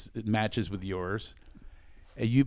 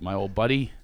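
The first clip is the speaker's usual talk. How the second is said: ordinary talk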